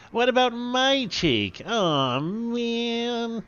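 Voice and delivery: high, loud, slow whine; Slavo's other catchphrase. Acted. Sidekick Slavo complaining about his cheek, high whine. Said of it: "Slavo's other catchphrase, which he says in a different but equally funny way".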